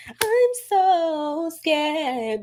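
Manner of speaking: hammed-up fearful whimper; mock scared whimper. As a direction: imitate someone crying in their feelings. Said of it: "grown man crying, grown woman crying in their feelings".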